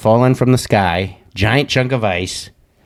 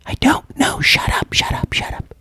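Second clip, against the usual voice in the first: hushed, urgent mock whisper; imitating frantic caller; 4 dB quieter than usual. Caller hushing him on the phone, frantic whisper. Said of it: "she was like".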